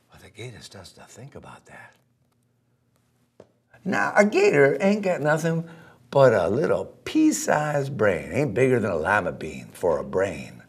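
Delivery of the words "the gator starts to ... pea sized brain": plain reading voice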